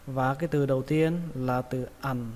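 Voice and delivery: normal talking voice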